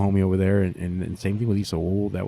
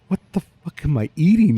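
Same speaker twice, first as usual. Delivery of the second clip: higher, louder disbelieving cry; amazed outburst; 7 semitones higher, 6 dB louder than usual. Retold or imitated. Retelling tasting the sides at Truth, amazed loud outburst. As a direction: blurt it like you can't believe the taste.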